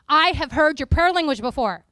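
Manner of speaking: declared loudly in astonishment; loud astonished claim. Retold or imitated. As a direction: announce it loud and amazed. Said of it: "she goes".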